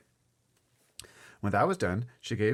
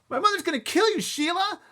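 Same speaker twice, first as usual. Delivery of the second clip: put-on high, loud boyish threat; angry warning; 8 dB louder than usual. A narrator's reading. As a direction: warn her she's in big trouble. Boy threatens careless girl.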